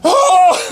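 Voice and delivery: high, loud held cry; imitated yell. Imitating a character yelling underwater, high loud yell. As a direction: imitate a long high scream. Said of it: "you hear him yelling underwater".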